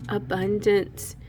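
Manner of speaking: ordinary talk, nothing marked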